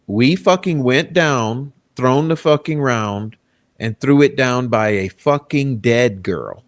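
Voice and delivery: slow, every word weighed like evidence; quoting a confession slowly to the audience; slow emphatic reading. Shown as a demonstration. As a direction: read it out slowly so nobody misses a word. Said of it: "read the words".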